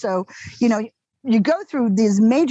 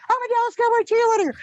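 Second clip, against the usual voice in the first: high-pitched, quick reenacted cheer; excited proclamation; 13 semitones higher, faster than usual. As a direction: crow the news in a giddy, high rush. Honking, yelling out her news while driving.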